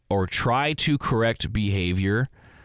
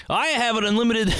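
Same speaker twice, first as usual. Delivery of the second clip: high-pitched, cracking up into a laugh; breaking into laughter; 12 semitones higher than usual. Real, not acted. Host admits judging people, breaking up laughing. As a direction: start grandly, then lose it laughing.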